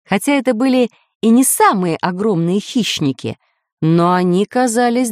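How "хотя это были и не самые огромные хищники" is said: usual speaking voice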